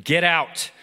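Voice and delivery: an angry boss throwing a worker out. Acted. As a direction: fire him with contempt.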